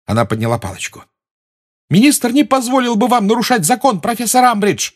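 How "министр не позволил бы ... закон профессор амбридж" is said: high, loud protesting cry; shouting. A narrator's reading. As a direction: shout it up at her. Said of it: "крикнула Гермиона". (громкий крик)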